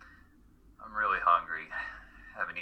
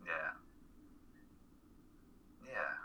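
whisper; 18 dB quieter, much faster than usual. Acted. Quiet reply, drifting off.

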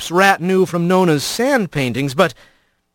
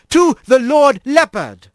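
higher, grand ceremonial speech; squirrel priest voice; 8 semitones higher, 3 dB louder than usual. A narrator's reading. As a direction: proclaim like a proud old priest. Alphonse's toast.

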